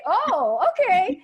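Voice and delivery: high, loud surprised "okay"; mock surprised mom. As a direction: act the startled mom. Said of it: "I'm like".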